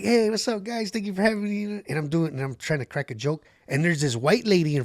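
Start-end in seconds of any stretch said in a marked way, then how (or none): 0.0-1.8 high, bright hello to the room; upbeat stage greeting. Retold or imitated. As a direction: greet the crowd with energy. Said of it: "I'm like".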